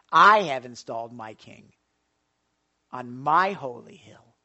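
loud, low proclamation; proclaiming God's word. Acted. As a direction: declare it as God's decree.

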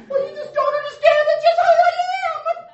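mock the defensive believer with a shrill high whine; whiny excuse. Pastor mocking a believer who refuses to change, high hammed-up whine.

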